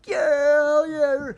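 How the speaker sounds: high, loud whooping yeah; drunk cheer. Retold or imitated. Luke cheering from the back seat, loud high whoop.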